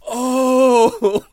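the penny drops; dawning realization. Retold or imitated. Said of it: "then I realized".

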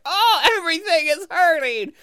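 high, strained comic wail; hammed-up agony in a high little voice; pained elf. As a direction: wail like a tiny crushed creature. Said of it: "in constant pain".

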